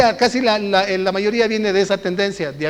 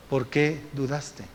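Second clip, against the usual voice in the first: very soft, slow, pressing; hushed question; 6 semitones lower, 11 dB quieter, slower than usual. Acted. Jesus pressing Peter with the question, soft and slow. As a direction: press him gently again.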